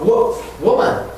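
woman, starting with a rounded wo, said slowly; slow woman with a strong W; clear W sound. Shown as a demonstration. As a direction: stretch the wo before woman. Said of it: "W 발음을 정확히 해주기".

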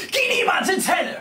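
put-on high shrieking old woman's yell; grandma shouting at the child, high and loud; grandma screaming. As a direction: scream the warning like a panicked granny. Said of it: "schreit sie".

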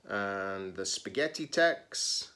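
ordinary delivery, nothing marked

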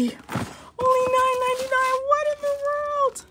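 very high-pitched, thrilled outburst; real excitement. Real, not acted. Shopper amazed by shoe deals, excited. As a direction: squeal it, pitch shooting way up. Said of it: "this sale is so exciting right now".

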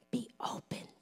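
hushed, whispered command. Acted. Repeats the command in a hush.

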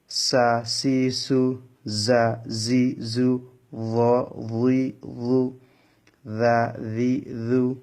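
slowly drawn out syllable drill, each sound held; slow syllable reading. Shown as a demonstration. Teacher reads Arabic letter syllables slowly for students to follow. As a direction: read each syllable slowly and clearly for learners. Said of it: "একটু ভালো করে লক্ষ্য করুন".

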